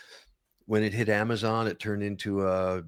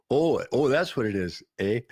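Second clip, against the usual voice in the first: loud, higher Minnesotan "oh, oh"; joking near-Canadian Minnesota accent; 3 semitones higher, 4 dB louder than usual. Retold or imitated. Newcomer learning what a quesadilla is, Minnesota-Canadian accent. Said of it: "we were so close to Canada".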